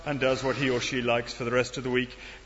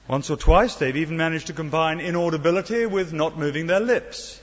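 ordinary talk

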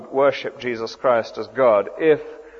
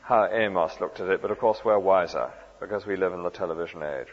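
lightly mocked dismissal, lower and softer; dismissive; 5 semitones lower, 5 dB quieter than usual. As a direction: brush them off quietly, imitating a condescending modern reader. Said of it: "We should never talk like that".

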